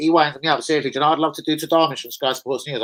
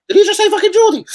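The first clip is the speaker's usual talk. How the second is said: very high, loud incredulous squeal; amused disbelief; 7 dB louder, faster than usual. Real, not acted. Cracking up over guest's misheard name, amused disbelief. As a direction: squawk it through a laugh.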